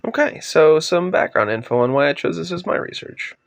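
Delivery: normal talking voice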